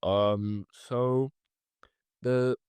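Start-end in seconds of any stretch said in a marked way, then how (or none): none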